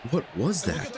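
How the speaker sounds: real disbelief at a dropped ball; baffled exclamation. Real, not acted. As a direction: gamer stunned by another fumble. Player drops the ball again.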